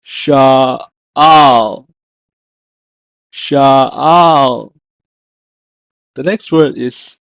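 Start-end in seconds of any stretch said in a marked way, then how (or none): 0.0-4.7 "sha'al" loud and slow, split in two; emphatic teacher's "sha'al", gap in the middle; Hebrew pronunciation demo. Shown as a demonstration. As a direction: break "sha'al" into two long, firm syllables. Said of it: "In Hebrew, is pronounced as".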